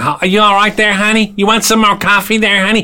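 high, sweet waitress voice calling everyone honey; American diner waitress. Retold or imitated. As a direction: play the doting diner waitress topping up coffee. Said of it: "I do love the coffee from the coming in".

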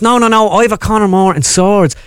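comic high Dublin voice; north side Dublin accent. Acted. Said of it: "a North Side dub".

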